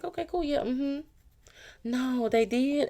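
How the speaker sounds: high, slow, sing-song mock agreement; fake agreeable innocent. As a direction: play the sweet pushover nodding along.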